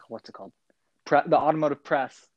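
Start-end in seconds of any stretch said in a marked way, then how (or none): 0.1-0.5 whispered aside. Real, not acted. Searching for word aside.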